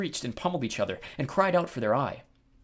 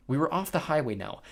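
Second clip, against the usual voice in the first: ordinary delivery, nothing marked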